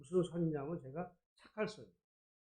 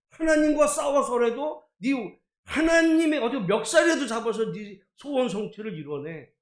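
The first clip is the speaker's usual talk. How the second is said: mock forceful exhortation; 4 semitones higher, 8 dB louder than usual. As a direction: imitate a preacher egging people on. Mimics forceful exhortation he then rejects.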